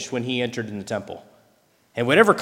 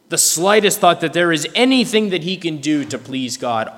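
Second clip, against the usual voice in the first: normal talking voice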